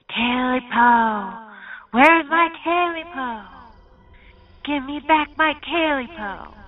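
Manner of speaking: high, slow, eerie demand; demanding creature. Acted. Creature demanding its Talipo, high and drawn out. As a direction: stretch it out like a hungry spirit.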